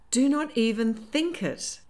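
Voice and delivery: high, slow, alarmed warning; soft exclamation. A narrator's reading. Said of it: "April exclaimed softly".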